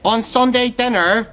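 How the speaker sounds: slow, deliberate "On Sunday dinner". Acted. Repeating for a listener who begged pardon, slow and deliberate. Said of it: "Say that again".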